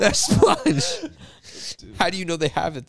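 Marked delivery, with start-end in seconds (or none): 0.0-1.1 laughing. Real, not acted. Echoing the joke.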